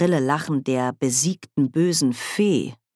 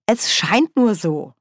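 "scheint" raised and stressed; betont; 5 semitones higher, 4 dB louder than usual. A narrator's reading. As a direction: lean emphatically on "scheint". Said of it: "betonte Nanny". ("scheint" mit Nachdruck betont)

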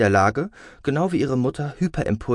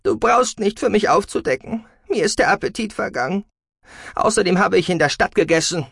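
high, loud, offended voice; indignant daughter storming off; huffy parting shot; 12 semitones higher, 4 dB louder than usual. A narrator's reading. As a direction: throw it back angrily while leaving. Daughter storms out after quarrel.

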